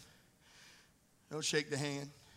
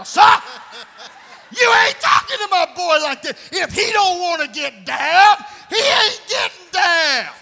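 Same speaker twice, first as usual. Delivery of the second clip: loud, high parent yelling at the coach; a hammed-up protective dad; mock angry parent; 4 semitones higher, 8 dB louder than usual.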